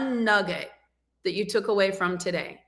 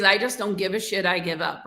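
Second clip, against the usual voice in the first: ordinary talk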